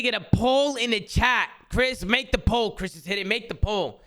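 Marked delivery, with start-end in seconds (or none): none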